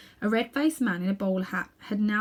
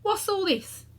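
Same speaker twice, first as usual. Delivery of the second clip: policeman; 11 semitones higher, slower than usual. Acted. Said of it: "said the policeman".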